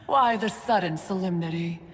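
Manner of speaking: laughing through the question; chuckle, then ask lightly; amused laugh. Acted. Amused at formal apology.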